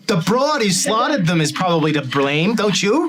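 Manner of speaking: high, loud rising rant; angry manager. Acted. George yelling at the captain, high and loud. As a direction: blow up at the watch captain.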